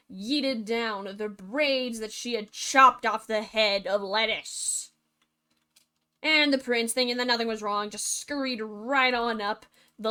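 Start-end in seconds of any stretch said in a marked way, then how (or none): none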